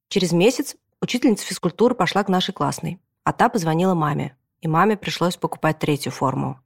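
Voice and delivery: ordinary delivery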